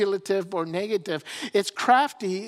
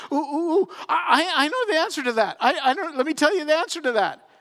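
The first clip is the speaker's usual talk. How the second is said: high, louder, eager ooh ooh; eager know-it-all; 8 semitones higher, 5 dB louder, faster than usual. Acted. Eve eager to answer the snake, high excited voice. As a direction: bounce like a kid who knows the answer. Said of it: "Eve looks at the snake and says".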